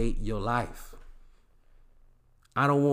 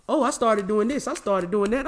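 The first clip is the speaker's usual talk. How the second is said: higher, mock bragging ex; mock girlfriend brag; 6 semitones higher than usual. Imitating an ex bragging to the host about her progress. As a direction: parrot her proud little update.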